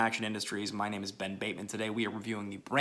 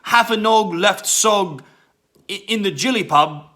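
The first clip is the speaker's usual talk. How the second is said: hammed-up English accent, slow, higher and louder; mock English slang; 8 semitones higher, 8 dB louder, slower than usual. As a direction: parody British gangster slang, dragging each word. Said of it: "there's lots of English slang".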